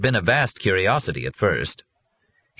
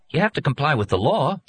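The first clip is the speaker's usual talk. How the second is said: on the defensive, higher pitched; 9 semitones higher than usual. A narrator's reading. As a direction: justify yourself, a bit higher. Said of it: "said in a defensive voice".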